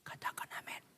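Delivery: whispered cue to say amen; preacher softly prompting the amen. Acted. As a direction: breathe it to the congregation. Preacher cues congregation.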